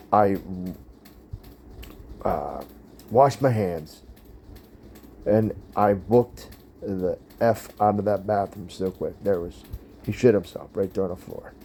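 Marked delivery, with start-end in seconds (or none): none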